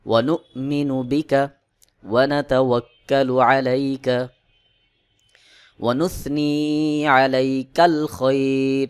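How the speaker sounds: slow, measured Arabic chant with long-held vowels; Quran-style recitation. Shown as a demonstration. Teacher reciting Dua Qunut for learners to memorise, slow chanted recitation. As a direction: recite phrase by phrase, pausing for learners to follow. সেভাবে এবার পড়ে শোনাব.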